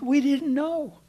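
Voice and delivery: high, whiny protest, we didn't know; defensive sons. Retold or imitated. Sons defend themselves to Jacob.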